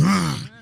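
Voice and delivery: quick pretend roar; playful lion roar. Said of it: "in Sweden means lion".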